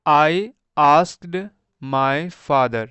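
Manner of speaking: slow reading aloud. Shown as a demonstration. Teacher reads sentence for class.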